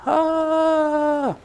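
high reverent "Ah" of the crowd; mock crowd gasp. The room reacting to each testimony, high awed "Ah". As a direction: breathe it out like a worshipping room. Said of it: "and they would go".

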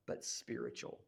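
low unvoiced near-whisper; preacher whispering the key word; hushed. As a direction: drop to a hush on the reveal. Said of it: "sermon point dropped to hush".

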